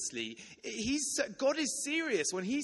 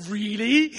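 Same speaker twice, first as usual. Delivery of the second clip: loud, rising cry of disbelief; can't believe it worked; a sudden loud disbelieving Really; amazed disbelief; 4 semitones higher, 12 dB louder than usual. Retold or imitated. Amazed her name was right.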